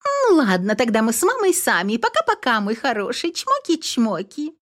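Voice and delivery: very high singsong coo; singsong cartoon princess. A narrator's reading. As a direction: sing it sweetly way up high. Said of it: "Пропела невеста в трубку".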